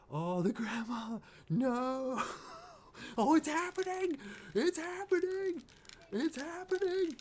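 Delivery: high, loud, drawn-out squealing alarm; squealing panic at a scary scene; panicked wailing. Real, not acted. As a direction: wail as the horror begins onscreen. Panicking at the grandma scene on screen.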